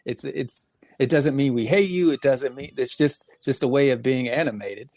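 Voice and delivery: ordinary talk, nothing marked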